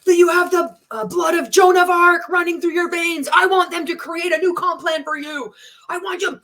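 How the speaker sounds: high shouting hype-man imitation; mock motivational speaker yelling; jacked-up hype. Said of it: "He'd get all jacked up".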